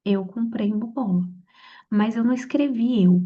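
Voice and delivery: ordinary talk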